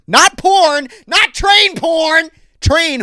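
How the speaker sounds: loud high yell, correcting himself; mock yell. Real, not acted. To the listener, correcting his own slip, a high shout.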